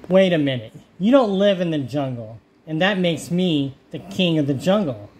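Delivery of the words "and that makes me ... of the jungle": ordinary delivery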